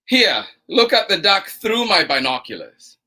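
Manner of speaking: lively, bouncy storytime read with two bickering voices; reading to children. Acted. Storytime host reading to children, animated and playful. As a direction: play both sides of the argument for the kids. Reads picture book aloud to kids.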